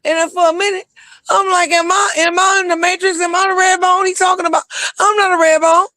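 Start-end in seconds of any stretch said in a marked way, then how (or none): 1.7-5.9 very high, half-crying mock panic; hammed-up panicked wail. Retells her panic over a song.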